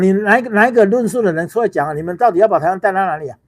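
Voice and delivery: ordinary talk, nothing marked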